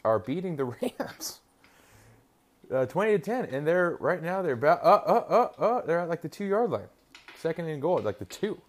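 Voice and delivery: high and giddy, laughing through the score; can't believe the Jets are doing this; excited laughing. Real, not acted. Watching the Jets game on TV, giddy and laughing.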